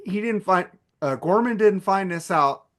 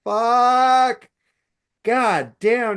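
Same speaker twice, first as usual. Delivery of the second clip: outraged curse; 5 semitones higher, 4 dB louder, much slower than usual. Retold or imitated. Retelling an outraged quote.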